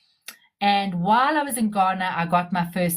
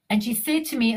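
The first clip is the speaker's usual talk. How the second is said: ordinary talk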